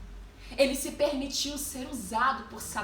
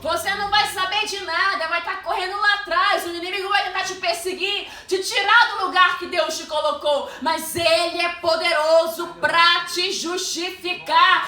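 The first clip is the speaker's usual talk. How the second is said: loud, high, driving sermon; impassioned preacher at full volume, stretching the climax; full-force preaching; 5 semitones higher, 9 dB louder, slower than usual. Acted. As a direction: proclaim it to the whole church.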